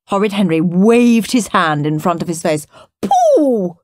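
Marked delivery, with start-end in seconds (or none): 3.0-3.8 mock Queen voice. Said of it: "said the Queen".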